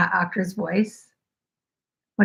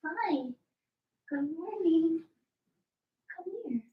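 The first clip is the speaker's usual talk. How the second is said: pet talk; 8 semitones higher, 11 dB quieter than usual. Real, not acted. Greeting the dog softly.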